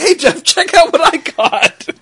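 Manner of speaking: slow, high excited shout to a friend. Retold or imitated. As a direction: show off a new buy, thrilled. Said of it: "Then you're like".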